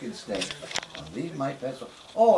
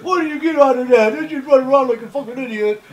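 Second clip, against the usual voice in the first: mocking older brother; 5 semitones higher, 7 dB louder than usual. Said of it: "brother mocking him playing ball".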